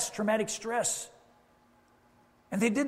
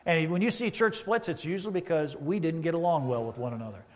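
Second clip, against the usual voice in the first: ordinary talk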